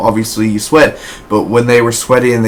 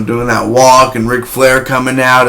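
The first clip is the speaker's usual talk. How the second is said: ordinary delivery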